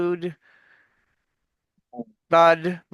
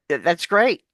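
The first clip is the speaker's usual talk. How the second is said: normal talking voice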